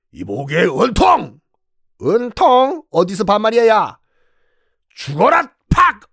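high, furious yelling; angry shout. Acted. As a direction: confront him and strike. Hang Woo attacks Eun Tong.

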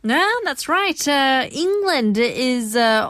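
ordinary talk

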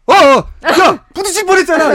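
loud, high mock scolding; retold backseat-driver outburst; nagging passenger. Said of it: "운전 타박 하도 해가지고".

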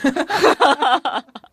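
loud bright burst of laughter; real laugh. Real, not acted. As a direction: laugh at your own performance. Laughing after her performance.

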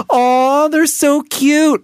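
put-on high, slow baby cooing. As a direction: coo over a cute baby. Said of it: "turning around to look at a baby".

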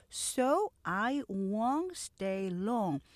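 slow, careful English model line; slow model sentence. Shown as a demonstration. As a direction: say each word slowly and clearly. Teacher models sentence slowly. (천천히 또박또박 읽는 예문)

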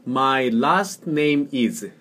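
very slow, evenly spaced English; teacher's careful slow model; slow model reading. Shown as a demonstration. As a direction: say it slowly for a learner to copy. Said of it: "해보세요".

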